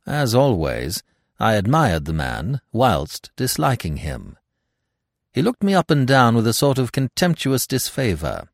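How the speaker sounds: ordinary delivery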